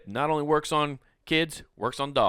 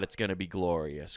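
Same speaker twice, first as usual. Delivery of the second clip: lower, softer relish; understated awe; 6 semitones lower, 5 dB quieter than usual. Real, not acted. Comedian anticipating fall without bugs, in a low understated voice. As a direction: drop it low before the big outburst. Low lead-in to exultant repeat.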